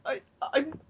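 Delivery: imitating scared self asking a cop for directions. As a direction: stammer it like a lost, frightened tourist. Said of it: "I was scared".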